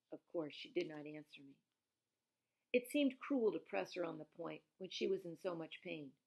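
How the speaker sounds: plain reading voice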